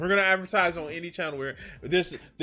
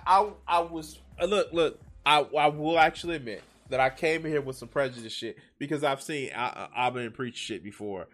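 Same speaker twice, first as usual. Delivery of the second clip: usual speaking voice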